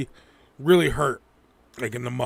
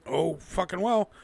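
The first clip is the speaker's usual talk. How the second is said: ordinary delivery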